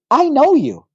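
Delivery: put-on high, chirpy child's voice; little boy voice. A five-year-old tells the pastor, in a high child's voice. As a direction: pitch it up like a small kid.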